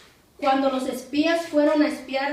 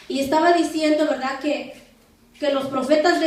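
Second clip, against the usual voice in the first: usual speaking voice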